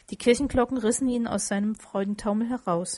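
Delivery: usual speaking voice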